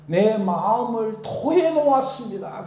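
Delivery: pouring-out plea. Retold or imitated. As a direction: pour out the heart. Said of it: "그렇게 얘기하잖아요". (마음을 쏟는 고백)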